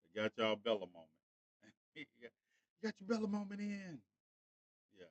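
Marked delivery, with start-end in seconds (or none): 1.9-4.0 high, cooing pet voice; pet talk. Real, not acted. Cooing to Bella after her appearance.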